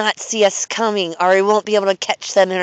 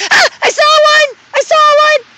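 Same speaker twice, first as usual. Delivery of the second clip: excited shout; 8 dB louder than usual. Real, not acted.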